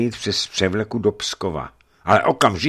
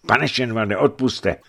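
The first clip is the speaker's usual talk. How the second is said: high, loud, urgent warning; breathless alarm; 9 semitones higher, 8 dB louder than usual. A narrator's reading. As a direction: blurt out the bad news in alarm. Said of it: "dlouho nemohl popadnout dech".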